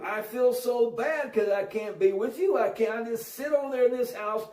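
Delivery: lightly voiced little girl, drawing out the plea; little girl pleading. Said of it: "This is a little girl".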